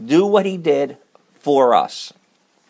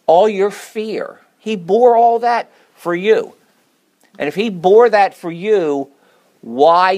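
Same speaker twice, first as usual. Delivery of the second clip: louder, higher, slowed preacher's cry; preaching at full force; 6 semitones higher, 5 dB louder than usual. Acted. Preacher to the congregation, rising to a loud cry. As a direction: lift the room, each "all your" ringing out.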